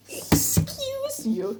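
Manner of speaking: high, slow, drawn-out excuse you; imitating a catchphrase voice. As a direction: stretch it out high like a quote. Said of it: "That's also a reference".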